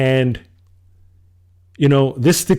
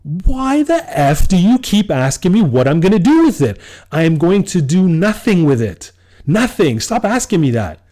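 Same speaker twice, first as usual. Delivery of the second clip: retold screaming; 6 semitones higher than usual. Friend pushing him over the phone, he yells back angrily. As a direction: yell back down the phone in frustration. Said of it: "I start screaming in the phone".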